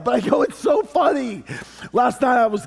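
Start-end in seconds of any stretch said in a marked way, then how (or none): 0.4-1.4 mock-excited husband, higher and stretched on so; pleading it's so funny to his wife; excited to share. Husband coaxing wife to watch another funny video, excited.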